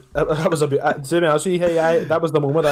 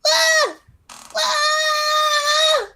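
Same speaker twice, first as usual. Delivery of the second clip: very high imitation squeal, drawn out; high mock shriek; mock crowd squeals. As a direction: squeal like a crowd member shouting out. Acting out crowd shouting answers.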